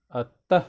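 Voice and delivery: अतः with a loud, forceful final तः; stressed syllable. Shown as a demonstration. Teacher giving stress examples, अतः punched on its ending. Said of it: "ज़्यादा विशेष बल लगा के बोलते हैं".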